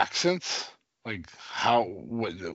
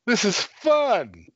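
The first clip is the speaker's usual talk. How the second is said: mock director; 6 semitones higher, 3 dB louder than usual. Mocking the director.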